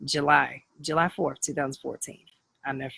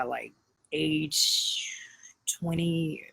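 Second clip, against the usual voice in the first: whisper; much slower than usual. Real, not acted. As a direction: drop to a whisper for the age. Stressing how young at graduation.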